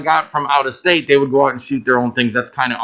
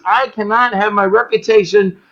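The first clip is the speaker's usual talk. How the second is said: imitating a strict director; 6 semitones higher than usual. Rock video director warning the crew about masks, bossy and raised. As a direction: bark it like a set director laying down rules. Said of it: "He goes".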